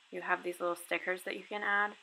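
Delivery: usual speaking voice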